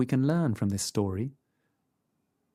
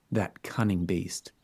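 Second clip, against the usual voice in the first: usual speaking voice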